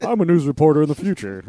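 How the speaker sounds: loud, high future newscaster parody; mock news reporter. Co-host topping the reporter bit from the show, hammed-up reporter voice.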